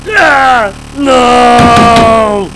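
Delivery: high, loud wailing screams of despair. Real, not acted. Gamer watching a blue screen, screaming in despair. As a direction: scream as the computer dies.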